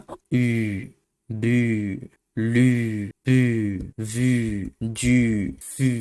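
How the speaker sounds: "উ" said thin and tight-lipped in "বু, লু, পু"; thin French u. Shown as a demonstration. Teacher drills students on a thin French "উ". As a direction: squeeze the lips and keep "উ" thin. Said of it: "চিকন হইতে হবে".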